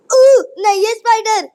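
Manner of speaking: high, loud panicked yell at the spider; screaming. Real, not acted. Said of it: "चिल्ला क्यों रहे हो?".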